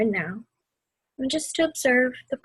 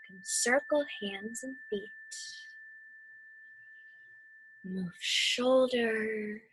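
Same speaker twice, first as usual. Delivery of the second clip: guided meditation. Acted. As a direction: ease them through the storm and back to calm.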